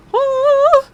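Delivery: high, loud "woo"; celebratory whoop. Real, not acted. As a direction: let out a long high whoop. Celebrating reaching Friday.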